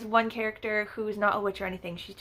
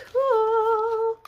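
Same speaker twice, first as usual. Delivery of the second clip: much higher, louder squeal of delight; cool, squealed high and loud; excited squeal; 13 semitones higher, 7 dB louder than usual. Real, not acted. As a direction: squeal it like a happy fan. Reacting to signed book.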